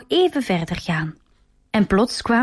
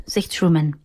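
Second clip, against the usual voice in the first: plain reading voice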